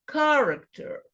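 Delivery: "character" high on CAR, falling away; stress on first syllable. Shown as a demonstration. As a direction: hit the CAR of "character" high, drop the rest. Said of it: "sílaba pronunciada com mais força".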